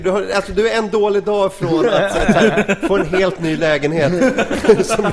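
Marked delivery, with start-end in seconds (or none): 0.5-4.1 loud, high, amused riffing; real laughter in a raised voice; laughing while talking. Real, not acted. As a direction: push the joke out through laughter. Joking on through the group's laughter.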